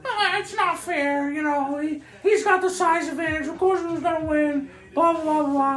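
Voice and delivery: high, whiny mock kid voice; nasal playground whine, mocking. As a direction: whine it like a sore loser. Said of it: "some butthurt ass kids that were like".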